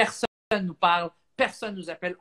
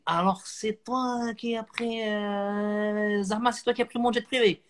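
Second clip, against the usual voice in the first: Jamel voice; 4 semitones higher, slower than usual. Retold or imitated. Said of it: "Jamel qui me dit".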